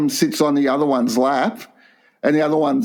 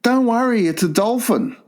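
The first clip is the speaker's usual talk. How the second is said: lightly voiced, higher carefree assurance; hint of false reassurance; 8 semitones higher than usual. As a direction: breezily wave the worry away. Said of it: "And the last one is".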